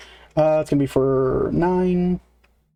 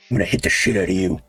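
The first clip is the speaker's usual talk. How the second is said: low, fast orc snarl; orc threat; 8 semitones lower, faster than usual. Acted. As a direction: menace the player as a brute orc. GM voices orc's reply.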